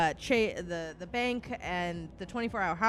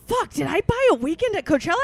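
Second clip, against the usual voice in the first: high, loud panicked question; alarmed; 8 semitones higher, 6 dB louder than usual. Retold or imitated. On the bank call, panicking over a charge. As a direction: gasp it out in disbelief.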